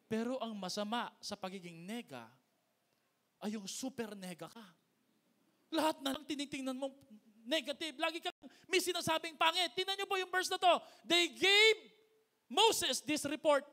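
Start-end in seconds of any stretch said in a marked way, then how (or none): 5.7-13.7 loud, high, rising sermon climax; preaching at full force. Acted. Pastor thunders at the congregation, full-force preaching.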